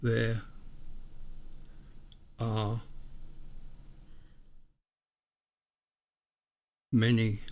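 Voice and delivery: each word spoken alone after a long pause; solemn reading, one word at a time, as if receiving it; measured, deliberate dictation with long gaps between words; word-by-word channelled message. Acted. Reads pendulum message word by word.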